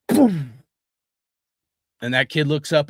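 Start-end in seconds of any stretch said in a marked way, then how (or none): 0.1-0.5 mock punch sound. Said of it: "He goes".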